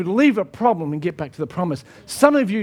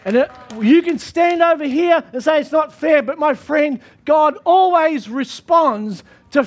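full-force preaching; 5 semitones higher than usual. Acted. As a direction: drive the promise home to the crowd.

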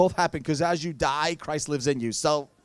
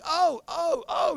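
high, mock-swooning gasps of oh; overwhelmed stewardess; 9 semitones higher, much slower than usual. Ticket lady shocked by the glory, imitated swooning. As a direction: imitate her overcome, pitch leaping up. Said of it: "she was like".

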